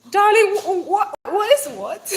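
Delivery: high, loud, laughing through the words; giggling incredulous reply; laughing disbelief. Real, not acted. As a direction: crack up at the question.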